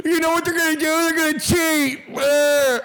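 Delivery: high, whining caricature of a commenter; mock the doomsayer in a high put-on voice; high, dragged-out silly wail; mock announcement. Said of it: "then you announce, you're like".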